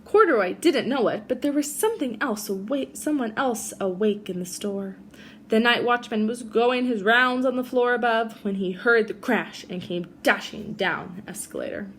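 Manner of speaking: put-on gentle read-aloud for children; reading a story to a class; storybook reading. A narrator's reading. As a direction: tell it like a bedtime picture book. Reads picture book to kids.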